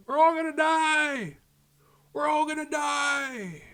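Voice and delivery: yelling from a cell. Retold or imitated. Doug yelling from his cell at the festival, slow high mock scream. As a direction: wail it out the jail window. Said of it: "He's like".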